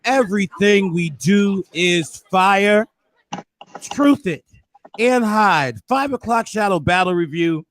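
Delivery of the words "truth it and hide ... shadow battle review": ordinary talk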